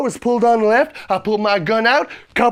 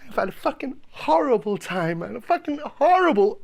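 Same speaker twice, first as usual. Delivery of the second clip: ordinary talk, nothing marked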